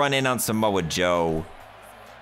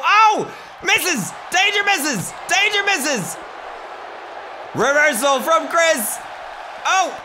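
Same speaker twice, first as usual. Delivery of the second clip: excited calls. Real, not acted. Calling the match excitedly.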